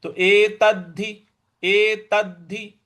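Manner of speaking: एतद्धि with a held, doubled द; एतद्धि said slowly, pressing the doubled द; doubled d stressed. Shown as a demonstration. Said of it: "आघात देना है".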